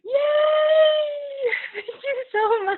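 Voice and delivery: squealing with joy, then thanks through tears; real happy tears in the thank-you; overjoyed shriek. Real, not acted. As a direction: burst out with a high, loud, delighted yelp. Joyful shriek at group invite.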